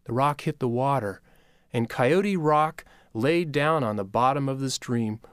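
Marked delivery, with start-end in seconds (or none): none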